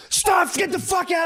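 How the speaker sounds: high, slow, hammed-up yell; mock screaming. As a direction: scream it like the video guy. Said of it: "and I was like".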